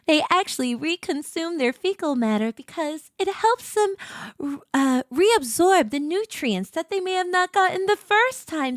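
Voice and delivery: mock-serious, sincere expert lecture; zoologist voice. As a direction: explain it very earnestly, like a zoologist. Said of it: "let me do my zoologist voice".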